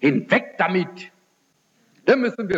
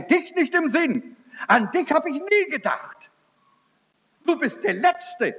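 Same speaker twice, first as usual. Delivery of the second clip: mother's dismissal; 12 semitones higher than usual. Retold or imitated. Mother rejects son's calling.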